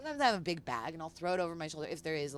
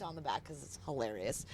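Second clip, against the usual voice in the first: usual speaking voice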